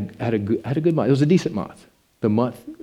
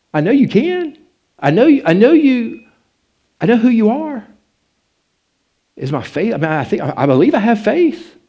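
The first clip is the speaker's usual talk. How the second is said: much higher and louder, pleading; anguished plea; 9 semitones higher, 6 dB louder than usual. Retold or imitated. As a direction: cry out to God in frustration. Retold pleading prayer at night.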